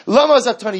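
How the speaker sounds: high, loud, insistent sermon voice; fiery sermon at full force; impassioned preaching. Acted. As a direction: drive it home to the congregation.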